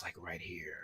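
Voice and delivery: hushed whisper close in. Shown as a demonstration. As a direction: breathe it as a whisper. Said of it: "And the voice was".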